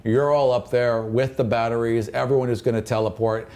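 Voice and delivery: ordinary delivery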